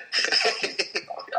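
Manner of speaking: short high-pitched burst of laughter. Real, not acted. Laughing at own retold story.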